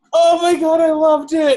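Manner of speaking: high, loud delighted cry; loudly cheering a friend's silly accent, delighted; delighted shout. Real, not acted. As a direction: burst out with gleeful approval. Delighted by the Cockney bit.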